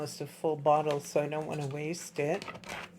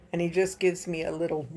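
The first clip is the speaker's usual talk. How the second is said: ordinary delivery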